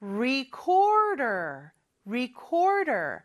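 "Recorder" slowly, with a curled English R; "recorder" with the R sounds clearly rolled back; careful English R. Shown as a demonstration. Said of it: "R 발음을 주의해야 되겠죠?".

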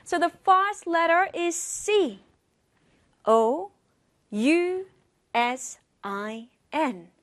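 C O U S I N spelled letter by letter, slow and clear at the end; over enunciating. Shown as a demonstration. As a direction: spell each letter of C O U S I N crisply, slowing on S I N. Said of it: "Let's spell it out".

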